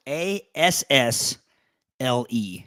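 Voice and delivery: slow letter-by-letter spelling; spelled out. Shown as a demonstration. Cohost clarifying the word's spelling, slow deliberate spelling. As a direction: spell it out slowly, one letter at a time.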